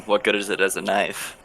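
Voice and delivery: ordinary talk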